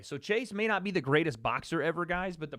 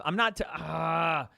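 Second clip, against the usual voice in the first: ordinary delivery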